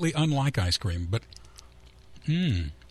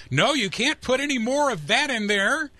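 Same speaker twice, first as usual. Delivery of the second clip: much higher, slow mock scolding; corporate boss; 9 semitones higher than usual. Corporate guy looking down on ice cream maker, mock officious. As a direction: lay down the rule like a condescending company man. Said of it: "corporate guy looking down and saying".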